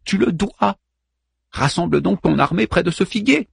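wife urging Hector to defend the wall, urgently pleading; torn between love and pain. Acted. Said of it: "déchirée entre l'amour et la douleur".

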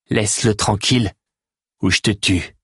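steady, stress on Laisse-le, level menace; Théo warning the Grax off the stranger, firm threat. A narrator's reading. Threaten him calmly, weight on Laisse-le. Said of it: "La voix de Théo était étrangement ferme".

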